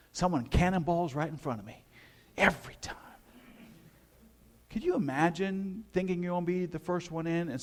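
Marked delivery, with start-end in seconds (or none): 2.4-3.0 whispered aside. Real, not acted. Aside about being beaten to pool.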